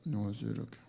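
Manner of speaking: low, soft and clipped even colder hello; hardened greeting. Retold or imitated. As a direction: mumble it shut like a stone. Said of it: "냉랭해로 굳어버립니다".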